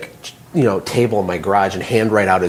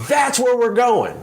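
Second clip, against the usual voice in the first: much higher, slow call from the treetop; mock scream down; 12 semitones higher, slower than usual. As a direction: call it down like a leader shouting from a tall tree. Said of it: "he screams down to the ground".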